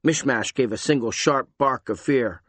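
ordinary delivery

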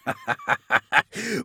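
high, loud cackle; villainous laugh. A narrator's reading. Chaos lord mocking the wounded captain, booming evil laugh. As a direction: laugh like a gloating monster. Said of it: "the figure was laughing".

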